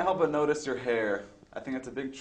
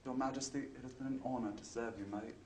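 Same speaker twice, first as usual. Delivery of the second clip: quiet, soft try at a British accent; a timid attempt at British English; 14 dB quieter than usual. Shown as a demonstration. Interviewer trying a British accent, soft and unsure. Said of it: "Can I try my British accent?".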